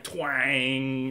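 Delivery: drawn-out mock Southern twang. Mocking her accent's sudden return, exaggerated Southern twang. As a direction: stretch it into a parody Southern twang. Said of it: "and then it's just".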